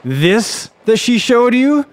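dramatic storybook outcry; crying out. A narrator's reading. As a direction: cry it as a menacing reveal. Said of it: "cried the soba man".

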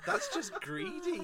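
drawn-out mock indignation; laughing fake disgust; mock outrage. Joking outrage at syllables.

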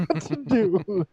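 high, singsong fretful sigh; mock fretting echo. Echoing friend's sweeping housewife, high singsong fret.

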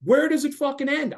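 high, loud exasperated cry; outraged. Real, not acted. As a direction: throw the question up in outrage. Ranting, echoing friend's question.

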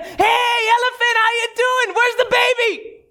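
a proud mother dog showing off her pups; perky dog voice. Acted. Mother dog bragging to the elephant, high perky voice.

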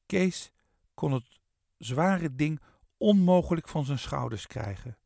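ordinary delivery

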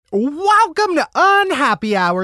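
hammed-up posh British host voice; playful fake British accent; mock British accent. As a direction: welcome them like a fake Brit, pitched high. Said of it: "I'm doing it in my native British tongue".

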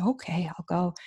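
meek whispered give-in; a small voiceless okay. Retold or imitated. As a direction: barely breathe the words, cowed. Meek reply after furious friends.

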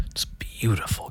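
"beautiful" whispered, soft and slow. Real, not acted. Repeating "beautiful" to listeners in a whisper. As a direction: hush the word "beautiful".

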